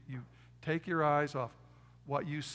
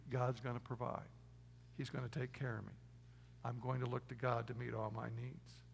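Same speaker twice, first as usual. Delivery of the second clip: soft, prayerful, lower affirmation; quiet affirmation; 3 semitones lower, 7 dB quieter than usual. Shown as a demonstration. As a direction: murmur it like a personal creed. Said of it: "you say this".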